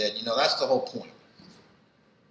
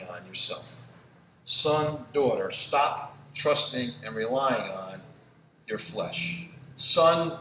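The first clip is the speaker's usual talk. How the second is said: pleading preacher; slower than usual. Acted. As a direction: plead gently with each listener. Preacher pleads as God's call.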